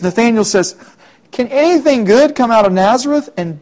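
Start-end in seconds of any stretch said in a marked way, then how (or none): 1.3-3.3 higher, louder scoffing question; mock the town with a sneer; sarcastic sneer. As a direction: cynical put-down of Nazareth. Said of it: "the sarcasm and the cynicism in his voice".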